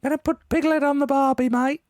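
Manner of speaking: cheerful cartoon bear with a barbecue twang; Aussie Pooh. Joking about cooking Piglet, high Aussie Pooh voice. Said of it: "Australian Winnie the Pooh saying".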